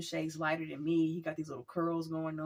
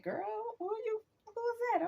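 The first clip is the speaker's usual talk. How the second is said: put-on very high-pitched girlfriend gossip voice; mock nosy astonishment. Friends' nosy looks, voiced.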